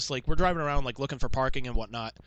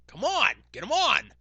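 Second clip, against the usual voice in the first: high, drawn-out pleading holler; imitated heckler's demand; 10 semitones higher than usual. Fan standing up calls to the host, heckling. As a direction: call out slowly like an insistent fan.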